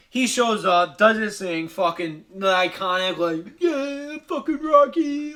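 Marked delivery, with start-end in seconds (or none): 3.6-5.3 high, stretched mock victory cry; triumphant cheer. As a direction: cheer like a champion with arms up. Acts out iconic triumph cheer.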